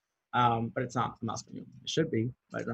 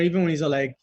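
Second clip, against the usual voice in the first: usual speaking voice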